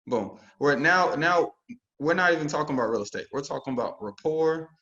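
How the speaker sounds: ordinary delivery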